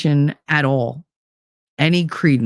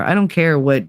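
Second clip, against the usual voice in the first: ordinary talk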